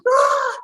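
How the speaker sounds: high excited cry of delight; joyful shriek. Retold or imitated. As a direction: let out a happy shriek. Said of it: "running through the house".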